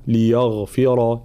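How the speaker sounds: "ليغفر" slowly with a light ياء and a heavy غين; tajweed pronunciation. Shown as a demonstration. Teacher modelling the word for students, slow. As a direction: keep the ياء in "ليغفر" thin, the غين full. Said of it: "الغين مفخمة وترقيق الياء قبلها".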